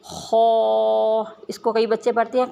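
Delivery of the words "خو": ordinary talk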